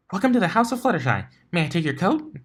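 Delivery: high, fast chirpy welcome; peppy high-pitched hostess voice; bubbly greeter. Acted. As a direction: rattle it off cheerfully. Pinkie greets stranger at door.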